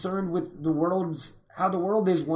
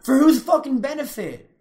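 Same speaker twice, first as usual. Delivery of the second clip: loud, high, incredulous challenge; indignant outburst; 8 semitones higher, 7 dB louder than usual. Real, not acted. Challenging co-host over never existing, indignant outburst. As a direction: fire the question back, voice raised.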